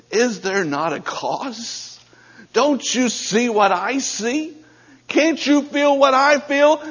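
David stirring his brothers, pitch climbing; building urgency. Acted. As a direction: plead with rising passion. David rallying brothers, rising.

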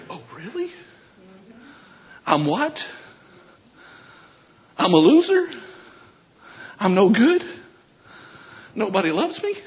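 rising, incredulous little questions; mock hurt disbelief. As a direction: play a gullible believer taking in insults. Voices gullible believer questioning Satan's lies.

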